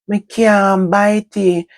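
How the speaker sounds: put-on high, slow child's whine; whiny child. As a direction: drag it out like a small girl refusing. Child whining she can't bite.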